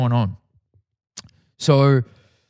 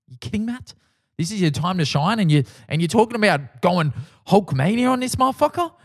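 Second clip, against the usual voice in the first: rising higher and higher in disbelief; incredulous self-mockery; 6 semitones higher than usual. Real, not acted. Roasting his own rap line, voice climbing in disbelief. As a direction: scold yourself for a dumb line, voice climbing. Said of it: "mocking his own line".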